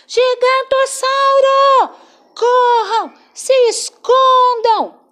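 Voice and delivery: high, loud panicked cry of warning; shouting alarm. Acted. Cabeçudo shouting warning to friends, high and loud. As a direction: yell to the friends to run and hide. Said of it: "ele gritou".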